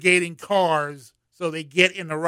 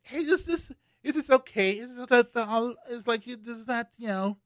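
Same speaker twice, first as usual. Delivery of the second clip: put-on hammy West Indian accent, pitched up; bad West Indian accent; 8 semitones higher, 3 dB quieter than usual. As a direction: imitate the West Indian mother badly. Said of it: "that's a bad West Indian accent".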